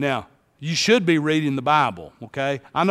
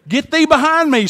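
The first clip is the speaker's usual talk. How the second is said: higher, louder commanding Jesus; mock rebuke; 10 semitones higher, 6 dB louder than usual. Preacher voicing Jesus rebuking Peter, mock rebuke. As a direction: fling it out like a stern rebuke.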